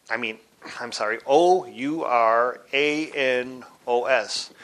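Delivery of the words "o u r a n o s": slow, one letter at a time; spelled out. Shown as a demonstration. Teacher correcting his spelling of a Greek word, slowly letter by letter. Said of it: "which is spelled".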